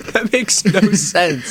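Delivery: can't believe what he just heard; laughing disbelief. Real, not acted. Disbelief at piggyback preference.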